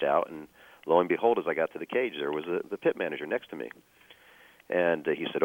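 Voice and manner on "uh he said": normal talking voice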